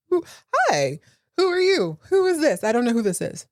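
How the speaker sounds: high, mock-confused "who is this"; baffled Cassandra. Cassandra baffled by Richard's outburst, high mock confusion. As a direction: play it dumbfounded and high.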